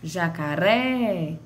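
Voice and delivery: "jacaré" called with a long final "ré"; "jacaré" leaning long on "ré"; calling out stretched. Shown as a demonstration. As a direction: stretch the last syllable of "jacaré". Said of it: "quando eu chamo a palavra".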